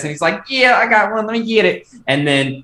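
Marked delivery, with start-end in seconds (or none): 0.5-1.9 put-on a light Southern kid's voice, higher; imitating his young cousin in a mild Southern accent. A cousin imitating his eight-year-old cousin, light Southern accent. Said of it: "doing my best impression".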